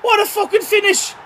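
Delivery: very high, full-blast celebratory screaming; ecstatic goal scream. Real, not acted. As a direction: scream your lungs out for the scorer.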